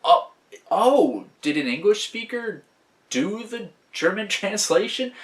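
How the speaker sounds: high-pitched, amused bewilderment; baffled realization. Acted. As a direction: act out a puzzled player catching on, laugh creeping in. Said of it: "must have had that moment".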